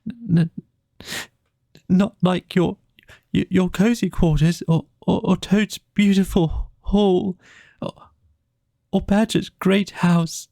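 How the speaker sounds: broken-hearted Mole, stuttering; tearful Mole. Acted. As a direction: choke the words out between sobs. Mole sobbing out his grief.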